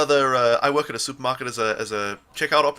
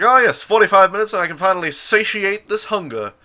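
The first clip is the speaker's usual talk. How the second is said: higher, louder mock relish; joking to customers, hammed-up eager relish; hammed-up eagerness; 6 semitones higher, 6 dB louder than usual. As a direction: ham up the hunger for a laugh. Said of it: "I was like".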